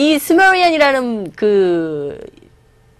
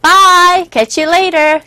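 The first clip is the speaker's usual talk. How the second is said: cheery goodbye; 6 semitones higher, 6 dB louder, much slower than usual. Shown as a demonstration. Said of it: "acts out a cheerful goodbye".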